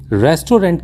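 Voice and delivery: रेस्टोरेंट said as "res-to-rent"; common mispronunciation. Shown as a demonstration. Teacher shows learners the common mistake, mispronounced. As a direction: say रेस्टोरेंट in three syllables ending "rent". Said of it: "कहना बिल्कुल गलत है".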